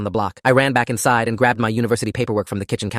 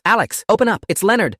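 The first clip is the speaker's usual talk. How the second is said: raised, high urgent call; urgent edge; 11 semitones higher, 4 dB louder than usual. A narrator's reading. Landlord banging on the tenant's door, urgent high shout. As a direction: call out loudly with an anxious edge. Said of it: "voice carried that edge he got when something was seriously wrong".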